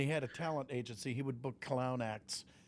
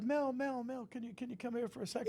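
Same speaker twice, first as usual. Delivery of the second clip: imitating the kid calling out; 9 semitones higher than usual. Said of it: "he goes".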